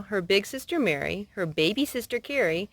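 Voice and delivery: usual speaking voice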